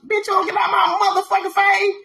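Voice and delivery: high-pitched indignant comic yell; mock outrage. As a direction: push him away with a high, hammed-up protest. Acted outraged rejection of his kiss.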